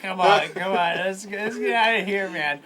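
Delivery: loud, laughing urge to leave; laughing hurry. Acted. Jeff drags his friend out of the bar, loud laughing. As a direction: hustle your friend out while laughing. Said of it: "Jeff's just like".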